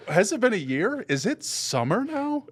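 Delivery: high-pitched, hammed-up incredulous questions; mock bafflement. Viewer lost in the movie's timeline, high mock disbelief.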